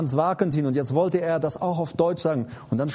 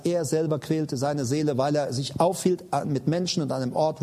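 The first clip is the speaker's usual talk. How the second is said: ordinary talk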